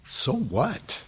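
soft rhetorical shrug of a question; dismissive, quiet. Acted. Preacher brushing off fear of seeming weird, quietly dismissive. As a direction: toss it off softly, rising at the end. Answers his own objection, first take.

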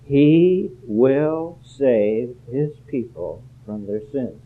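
slow, every word spaced out, stress on "he"; the key verse read slowly and solemnly; slow and weighty. Shown as a demonstration. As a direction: lay the verse down slowly and deliberately. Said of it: "Here's a purpose statement".

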